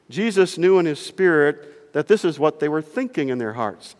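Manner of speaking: ordinary delivery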